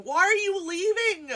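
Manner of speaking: very high, exasperated yelling; outraged wailing. Real, not acted. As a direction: wail at her in sheer outrage. Outraged at heroine leaving the man.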